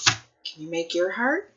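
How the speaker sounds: usual speaking voice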